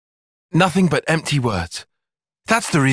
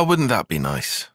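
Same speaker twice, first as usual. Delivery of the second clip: ordinary delivery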